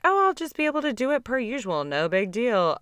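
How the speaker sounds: high, carefree, dismissive tone; breezy overconfidence. Retold or imitated. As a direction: toss it off like it's easy. Overconfident past self, quoted.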